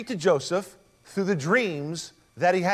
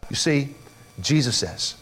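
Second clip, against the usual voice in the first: ordinary delivery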